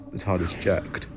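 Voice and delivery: ordinary delivery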